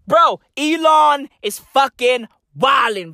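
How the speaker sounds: ordinary talk, nothing marked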